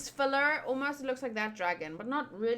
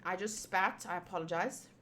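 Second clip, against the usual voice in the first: usual speaking voice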